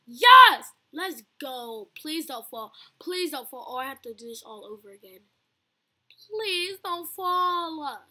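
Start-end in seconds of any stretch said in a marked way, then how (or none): 0.1-0.7 very loud cheer; triumphant shout. Real, not acted. Succeeds in the game. 6.3-8.0 very slow, stretched-out begging; drawn-out plea. Real, not acted. Begging the game character.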